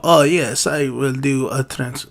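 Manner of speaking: low, soft attempt at a refined actor's accent; badly faked accent, low and hushed; Anthony Hopkins. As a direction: quietly put on a poor actorly accent. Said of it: "that's not a good accent".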